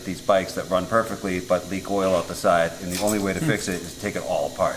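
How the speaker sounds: ordinary talk, nothing marked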